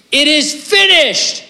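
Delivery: high, slow, ringing shout of victory; triumphant shout. Retold or imitated. Preacher voicing Jesus crying out from the cross, huge triumphant shout. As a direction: cry out the last words with full force. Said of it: "Jesus shouting from the cross".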